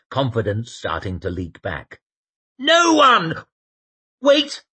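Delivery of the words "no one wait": high, loud alarmed shout. Acted. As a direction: shout the sudden warning. Temple shouts back from wagon.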